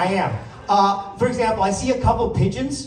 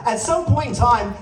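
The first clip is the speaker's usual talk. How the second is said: normal talking voice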